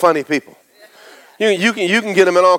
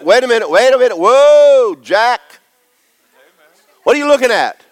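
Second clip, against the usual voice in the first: calling out; 9 semitones higher, 6 dB louder than usual. Real, not acted. Calling out to a congregant.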